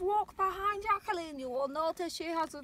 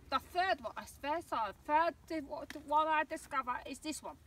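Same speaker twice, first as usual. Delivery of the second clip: usual speaking voice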